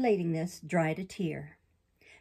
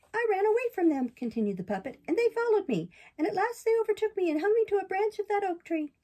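high, eager puppet voice; puppet boy voice; 12 semitones higher, 4 dB louder than usual. Acted. Pinocchio tells the fox and cat of the assassins in a high boyish voice. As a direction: redo the line as Pinocchio, boyish and excited. Sorry about that.

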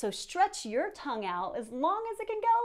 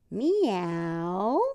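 playful meow; 5 semitones lower, 5 dB louder than usual. Pretend. Cat pose sound.

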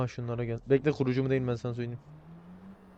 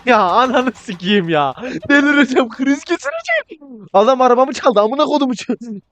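high, frantic wail of anger; exasperated outburst; 9 semitones higher, 3 dB louder, slower than usual. Pretend. Player whose car was stolen, exasperated outburst. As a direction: lose it, voice shooting up high.

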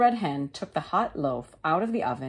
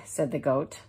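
usual speaking voice

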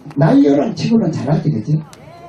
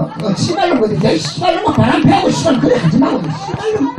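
high laughing swear; laughing curse; 11 semitones higher than usual. Real, not acted. Comedian repeating the crowd's curse, laughing. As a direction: laugh through the curse, higher. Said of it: "지금 뭐라 그러?".

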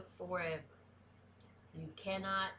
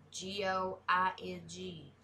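ordinary talk, nothing marked

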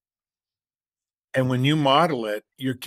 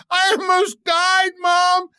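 put-on high, loud indignant boy reporting to mom; upset kid; 6 dB louder than usual. Whiny high child shouting. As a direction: son complaining to mom about dad fleeing, high loud child voice. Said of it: "He goes".

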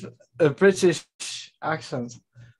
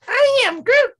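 high Groot squeak; 6 dB louder than usual. Retold or imitated.

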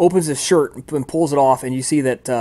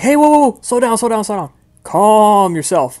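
urgent calming-down; 8 semitones higher, 6 dB louder than usual. Acted. Fawzia stops a helper blowing too hard, urgent halt. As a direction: rein in someone overdoing it.

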